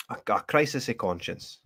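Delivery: ordinary talk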